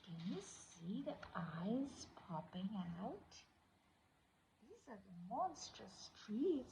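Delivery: low, hushed, ghostly voice; spooky whisper. A narrator's reading. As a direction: whisper it to give chills.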